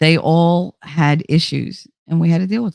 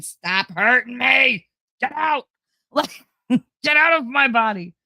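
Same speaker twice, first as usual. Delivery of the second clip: mock labor shouting; 7 semitones higher than usual. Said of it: "I'm actively saying".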